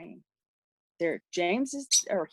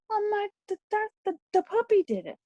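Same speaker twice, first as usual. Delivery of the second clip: put-on much higher, halting little boy's voice; imitating her scared son; 11 semitones higher than usual. Mother retelling her son's excuse, high halting child voice. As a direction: stammer it out like a frightened child covering up.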